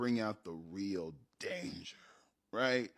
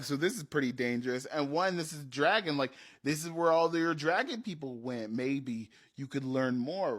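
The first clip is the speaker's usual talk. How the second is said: normal talking voice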